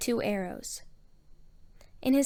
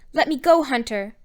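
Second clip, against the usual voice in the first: sharp, raised order, loud at the start; 3 semitones higher, 8 dB louder than usual. A narrator's reading. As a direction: order him to release you. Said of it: "she ordered".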